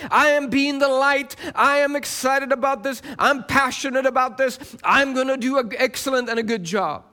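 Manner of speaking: impassioned sermon build in rhythmic phrases; fervent preaching. Real, not acted. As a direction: drive each declaration home like a preacher.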